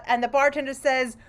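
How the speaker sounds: ordinary talk, nothing marked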